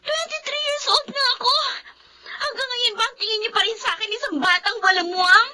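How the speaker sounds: sobbing, voice breaking as she protests; crying outburst. Acted. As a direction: cry it out at her sister, hurt and bitter. Blind sister breaks down, confronting family. (umiiyak na sumbat sa ate)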